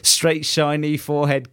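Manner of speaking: normal talking voice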